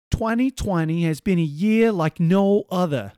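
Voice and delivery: hammed-up bad British accent drifting to New York; botched English accent. Host reading the BBC chief's statement, bad British accent. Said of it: "That was a New York accent".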